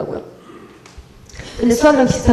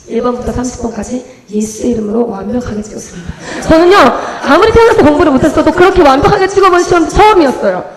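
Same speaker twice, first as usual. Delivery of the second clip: ordinary talk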